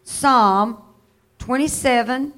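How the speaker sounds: ordinary talk, nothing marked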